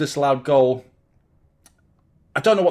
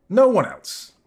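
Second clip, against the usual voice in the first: emphatic; 8 semitones higher, 5 dB louder, slower than usual. Real, not acted. Emphatic repeat.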